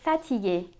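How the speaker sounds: fatigué ending in French "ay"; French é closing fatigué; accent aigu ending. Shown as a demonstration. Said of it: "words that have the accent aigu".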